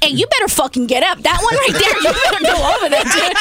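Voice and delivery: high, fast, laughing order; bossy but giggling; laughing command. Retold or imitated. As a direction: shoo him out while laughing. Retold ordering him out, laughing.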